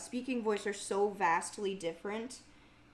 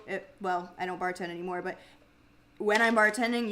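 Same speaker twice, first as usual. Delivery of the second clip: usual speaking voice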